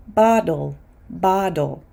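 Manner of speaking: "bottle" said like "boddle"; fast D for T. Shown as a demonstration. Teacher models American "bottle" with a fast D. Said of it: "because this T is before an L".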